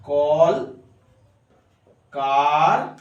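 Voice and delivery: "কল কারখানা" slowly, with long pauses between কল, কার and খানা; syllable by syllable. Shown as a demonstration. Teacher dividing a word for students, slow and separated. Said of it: "দল কতগুলো আছে?".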